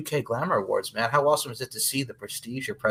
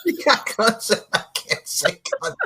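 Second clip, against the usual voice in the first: laughing; 12 semitones higher, 4 dB louder than usual. Real, not acted. Host repeats line laughing.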